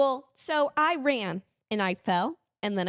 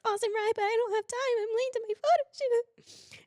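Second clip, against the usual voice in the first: high, teary, panicked rush; imitating her sobbing self, pitched way up; hammed-up tearful panic; mock crying; 12 semitones higher than usual. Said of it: "tears running down my face".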